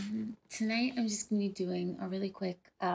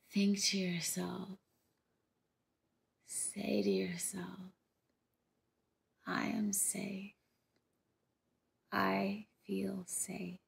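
slow, calm meditation delivery, unhurried pauses between phrases; guided meditation; slower than usual. Acted. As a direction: lead them gently through a visualization, drawing out key words. Leads the rainbow meditation.

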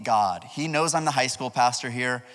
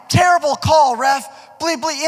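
high, loud mock shout at the ref; hammed-up angry shout; shouting player; 12 semitones higher, 8 dB louder than usual. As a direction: imitate him yelling in your face. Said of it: "He's like".

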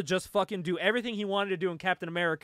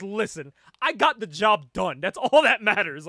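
brash dismissive Spice; 10 dB louder than usual. Retold or imitated. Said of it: "And she goes".

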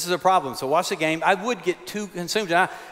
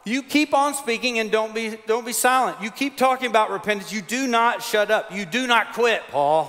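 loud, high, emphatic preaching; fiery pulpit preaching; full-force preaching; 6 semitones higher, 3 dB louder than usual. Acted. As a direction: preach it with fire.

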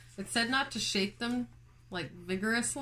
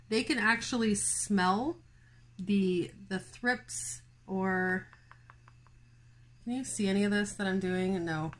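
ordinary delivery

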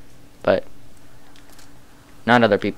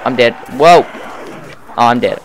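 real panic; 6 dB louder than usual. Real, not acted. Player getting killed in game.

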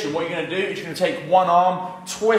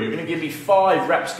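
normal talking voice

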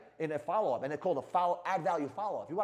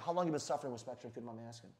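soft quick question; 7 semitones lower, 8 dB quieter, faster than usual. Retold or imitated. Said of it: "Let me ask you a quick question".

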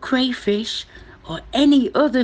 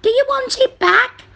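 high, raised mouse voice; little mouse voice; 5 dB louder than usual. Acted. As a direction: ask it as a squeaky little mouse.

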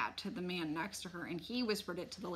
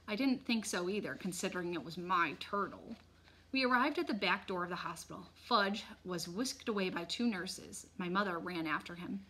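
ordinary delivery, nothing marked

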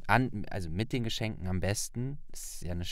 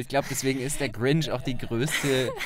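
laughing through the words, loud and slow; 4 semitones higher, 7 dB louder than usual. Real, not acted. Host laughs with Thea about the Christmas lie, laughing. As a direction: laugh while calling it the biggest lie.

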